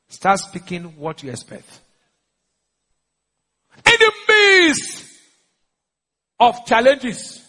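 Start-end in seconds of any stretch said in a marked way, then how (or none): 3.8-7.4 high, drawn-out preacher's cry; slow, high pulpit proclamation; preaching at full force. Real, not acted. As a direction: stretch each word out in a raised voice.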